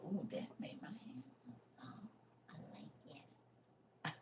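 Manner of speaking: pleased private whisper about soft hands; whispered aside. Real, not acted. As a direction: drop to a whisper as if only to yourself. Private aside about the hand lotion.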